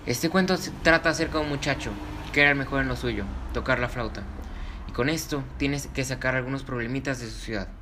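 put-on cheerful host welcome for kids; kids show host. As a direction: greet the children, bright and friendly. Host welcomes children to story.

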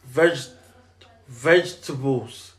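"vegetables" very slow, halting after veg; careful attempt. Real, not acted. Podcaster trying the word he can't say, "vegetables" slow and halting. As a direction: feel your way through "vegetables", veg, pause, tuh-bulls. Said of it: "I just can't say the word".

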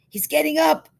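loud high call out; man at window calling out the news; excited call. Acted. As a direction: blurt out the news.

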